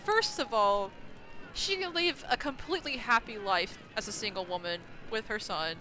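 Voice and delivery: ordinary delivery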